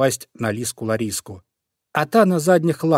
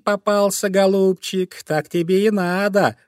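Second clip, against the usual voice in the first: higher mocking fox voice; gloating fox; 7 semitones higher than usual. Fox gloats over the trapped bunny, higher and mocking. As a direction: gloat over your prey.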